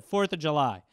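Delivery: ordinary talk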